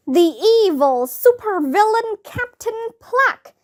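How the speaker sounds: high-pitched villain proclamation; grand announcement. A narrator's reading. Dentist presents herself as supervillain, grandly announcing. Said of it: "she announced".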